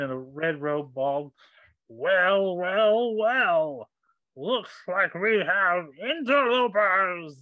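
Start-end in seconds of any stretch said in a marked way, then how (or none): 1.9-7.4 loud, raised, drawn-out villain voice; Krang-like villain. Acted. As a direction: gloat like a cartoon villain. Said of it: "You sound like Krang".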